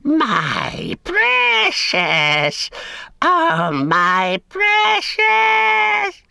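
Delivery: very slow, stretched, rising to a high shout; a creature gloating over his treasure, drawn out and shrieking; Gollum's ecstatic cry. A narrator's reading. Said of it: "Gollum cried".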